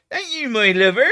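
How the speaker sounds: high, loud West Country Thank you, my lover; a broad Cornish thank you, my lover; Cornish accent. Shown as a demonstration. Doing the Cornish accent for her friend, high Cornish voice. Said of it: "Cornish accent".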